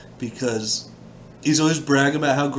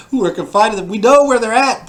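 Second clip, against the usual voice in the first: mocking; 10 semitones higher, 9 dB louder than usual. Real, not acted. Mocking the text's repetition.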